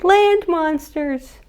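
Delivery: high, drawn-out cartoon alarm; mock alarm. Little sea monster hiding from a boat, mock alarm. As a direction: cry it out slowly in playful dread.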